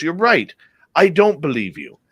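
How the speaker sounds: dry skeptical British accent, lightly imitated. Doctor dismissing patient's story, imitated British accent. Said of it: "in his British accent".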